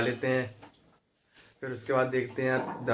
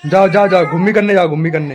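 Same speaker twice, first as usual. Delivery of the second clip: higher, louder sing-song coaxing; 4 semitones higher, 9 dB louder than usual. Real, not acted. Owner urging his puppy to go out for a walk.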